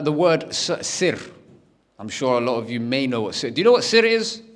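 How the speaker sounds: normal talking voice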